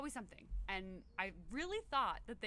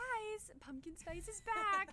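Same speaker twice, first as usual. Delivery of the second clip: high, slow, hammed-up ad voice; mock announcement; 12 semitones higher, 4 dB louder, much slower than usual. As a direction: chirp it like a cheesy promo. Mocking Starbucks announcement.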